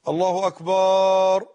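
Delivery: «الله أكبر» with the last vowel of «أكبر» drawn out to «أكبار»; stretched takbeer. Shown as a demonstration. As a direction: hold the end of «أكبر» long and loud-ish. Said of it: "إن أكبر هو الطبل". («أكبر» بمدّ الباء: «أكبار»)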